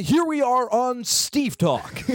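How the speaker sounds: booming high announcer call. Pretend. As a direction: hype up the crowd like a ring announcer. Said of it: "I love that announcer voice".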